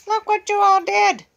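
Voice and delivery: high, loud shout stressing all; scolding shout. Real, not acted. Owner scolding the bird for tearing the rug, loud and high. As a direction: let it burst out at the bird.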